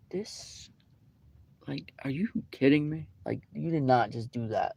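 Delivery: whispered disbelief, soft and breathy; incredulous whisper. Retold or imitated. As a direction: hiss it under your breath, stunned. Retelling her own inner reaction.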